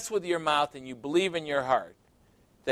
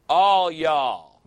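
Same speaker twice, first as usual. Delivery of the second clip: loud Southern "All y'all", drawn out; 6 dB louder than usual. Shown as a demonstration. Preacher showing the Southern way to a congregation, loud drawl. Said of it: "That's the way they say it in the South".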